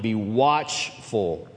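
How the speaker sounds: "watchful" drawn out very slowly; stress on watchful. Real, not acted. Preacher reading scripture to the church, stress on "watchful". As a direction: lean hard on "watchful", stretching it out. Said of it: "I'm emphasizing that word".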